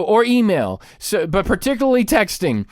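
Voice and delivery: normal talking voice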